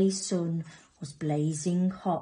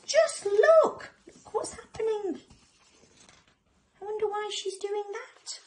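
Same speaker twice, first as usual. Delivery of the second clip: worried little cubs. Acted. Lion cubs watch the crocodile, high and worried. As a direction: start with a squeal, settle into high wondering. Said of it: "cried the lion cubs".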